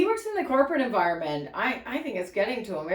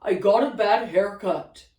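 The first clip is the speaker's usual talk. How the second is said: stranger quote; 5 dB louder, slower than usual. Retold or imitated. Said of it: "And he goes".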